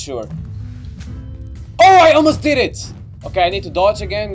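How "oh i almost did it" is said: loud, high burst of excitement; excited. Real, not acted. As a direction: cry out at the near miss. Near win in fight.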